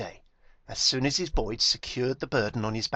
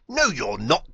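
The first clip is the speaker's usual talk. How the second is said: declared; 3 semitones higher, 9 dB louder than usual. A narrator's reading. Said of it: "declared Biggest Donkey".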